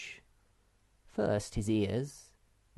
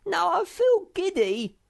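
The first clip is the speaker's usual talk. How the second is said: high, whining little-creature voice; squeaky complaint about feeling dizzy; giddy Sniff; 9 dB louder than usual. A narrator's reading. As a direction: whimper it like a woozy pet. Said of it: "refused to go any farther".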